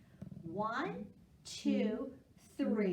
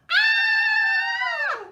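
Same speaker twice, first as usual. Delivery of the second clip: sung mock scream, much higher and louder; playful scream; 17 dB louder than usual. Teacher screams for fun at the crocodile line, playful mock shriek. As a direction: shriek like the crocodile just appeared. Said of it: "don't forget to scream".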